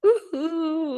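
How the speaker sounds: high excited cheer; excited whoop. Real, not acted. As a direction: greet with a big happy whoop. Entusiasmo ao ser recebida. (grito entusiasmado)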